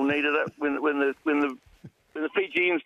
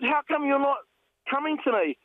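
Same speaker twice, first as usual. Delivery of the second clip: calling out; 10 semitones higher than usual. Retold or imitated. Calling to approaching fisherman.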